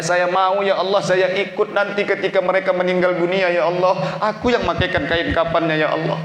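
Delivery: a preacher praying aloud for his parents, pleading; fervent prayer. Acted. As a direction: pray aloud with rising fervour. Fervent prayer within sermon.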